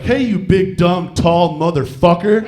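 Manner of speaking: loud, slow mock insult; mock yell. Wife yelling up at the tall guy, loud mock insult. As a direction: yell up at the big guy, hammed-up. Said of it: "And she's like".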